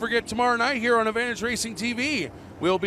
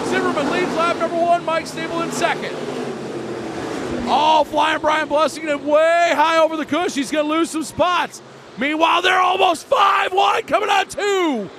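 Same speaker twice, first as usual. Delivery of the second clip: excited shouting; 5 semitones higher than usual. Real, not acted. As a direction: shout the action as it happens. Car flies high, five wide.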